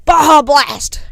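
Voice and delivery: put-on big hammy American-accent shout; practised American accent. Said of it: "still practicing my American accent".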